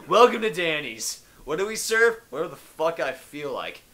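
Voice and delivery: hammed-up restaurant welcome; mock host. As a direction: announce it like a cheesy diner host.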